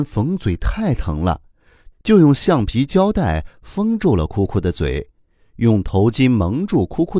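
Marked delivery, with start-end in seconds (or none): none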